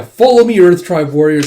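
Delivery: acted commander's rallying cry; rallying shout. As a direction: lead the charge with a shout. Lord rallies warriors into battle.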